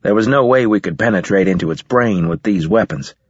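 ordinary delivery, nothing marked

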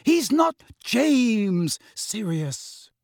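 high, very slow, pointed rebuke; pained, high-pitched insistence; emphatic reproach. A narrator's reading. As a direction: drag out each word in exasperation. Climax of quarrel over Harry.

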